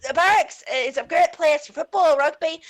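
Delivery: put-on thick Belfast accent on request. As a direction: tell it like a Belfast lad. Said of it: "do your Belfast accent".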